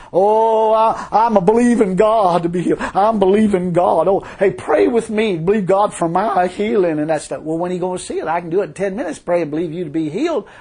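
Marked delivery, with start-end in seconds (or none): 0.1-7.0 exaggerated imitation of a needy plea; mock pleading. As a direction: wail it like someone begging for prayer. Mimics anxious believers begging prayer.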